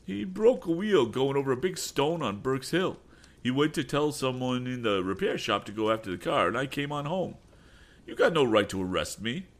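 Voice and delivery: put-on high, whiny young voice making excuses; sneaky bully. Sam excusing himself to Tom, whiny. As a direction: sound innocent while lying.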